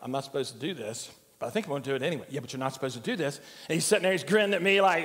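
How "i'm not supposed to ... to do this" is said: low, soft voice of conscience; inner voice. Acted. Grandfather acting out a child's inner voice, low and soft. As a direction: talk it over under your breath. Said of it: "You ever hear that voice?".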